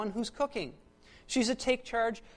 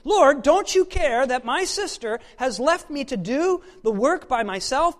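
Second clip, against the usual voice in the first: loud angry complaint, building to a slow shout; Martha; 3 semitones higher, 13 dB louder, slower than usual. Acted. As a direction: hammer out the last demand, word by word. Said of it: "She's angry".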